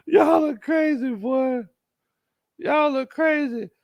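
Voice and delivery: high, laughing and slow. Real, not acted. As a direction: laugh it out at the chat. Laughing at chat jokes.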